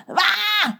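high shrieking caw; crow's screech. Acted. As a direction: screech in alarm. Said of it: "krijst Kraai". (Kraai krijst hoog)

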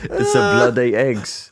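hammed-up British accent, drawn out; slow, laughably bad British accent. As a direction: attempt a terrible British accent. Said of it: "That was the worst British accent".

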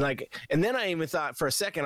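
ordinary talk

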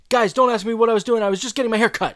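high, loud, rushed self-justification; defensive. Retold or imitated. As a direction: blurt it out defensively, high and fast. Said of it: "gets very defensive and weird".

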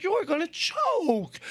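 slow, high coo to dog; high pet voice. Retold or imitated. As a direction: fuss over the puppy. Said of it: "I'm like".

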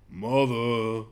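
lightly voiced lonely dinosaur call; mock dinosaur crying for its mother; brachiosaurus voice. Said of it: "in its dinosaur voice it was there going".

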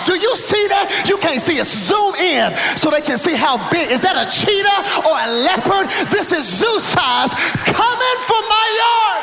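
high-pitched, comic outrage; comedian's hammed-up alarm at a big cat; worked-up alarm. Real, not acted. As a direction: insist on the danger, pitch way up. Mock-alarmed showing of bobcat video.

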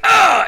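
sudden high yelp of frustration; high frustrated yelp; frustrated yell. Retold or imitated. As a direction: let out a sharp high cry at your own mistake. Frustrated after flubbing the line.

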